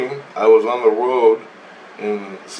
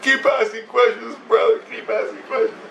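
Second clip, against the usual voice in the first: high-pitched mock crying plea; tearful imitation; 10 semitones higher than usual. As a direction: imitate a man talking through tears. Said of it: "He's crying".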